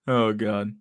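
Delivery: ordinary delivery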